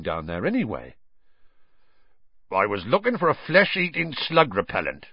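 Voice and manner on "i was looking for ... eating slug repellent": higher-pitched defensive growl. A narrator's reading. Hagrid explaining himself to Harry, growling. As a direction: growl out the excuse. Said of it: "growled Hagrid".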